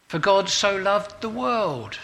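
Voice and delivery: "God" punched high and loud, said slowly; "For God so loved the world" with a loud, high "God"; stress on God. Shown as a demonstration. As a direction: hit "God" hard and lift it. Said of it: "giving emphasis to a different word each day".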